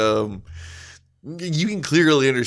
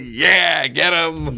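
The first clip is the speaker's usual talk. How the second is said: cheering; 5 semitones higher, 3 dB louder, much slower than usual. Retold or imitated. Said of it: "I was like".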